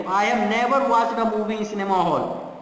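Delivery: slow, with "never" given the main weight; stress on never. Shown as a demonstration. Teacher telling friends he never went to the cinema, slow with "never" stressed. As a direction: dwell on "never", then let the rest run on. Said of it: "अब इस बात पे जोर दिया जाता है".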